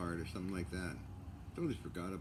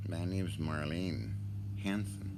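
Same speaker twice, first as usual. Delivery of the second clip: mock Marlene intro; slower than usual. Vlogger retaking his Marlene intro for a screenshot, slow. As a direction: introduce yourself slowly, lightly voiced. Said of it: "Let's try that again".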